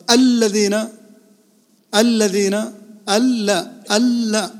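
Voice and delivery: الذين as al-ladhina, long held L; doubled lam. Shown as a demonstration. Teacher isolating the shadda, held L in الذين. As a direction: press on the double L in الذين and ألا. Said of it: "فيها شدة".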